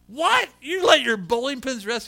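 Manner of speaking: high, loud incredulous question; imitated flabbergasted listener; astonished disbelief. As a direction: blurt it out in amazement. Man astonished pins are rested.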